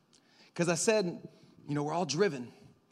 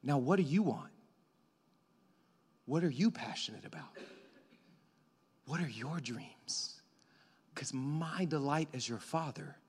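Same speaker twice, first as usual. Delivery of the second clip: a hint of a soothing God; tender father voice; 6 dB quieter than usual. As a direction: speak as a gentle loving father. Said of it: "God looks at you and says".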